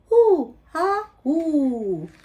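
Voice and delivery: high monkey hoot that settles down; monkey calls. Acted. Monkey call repeated at end.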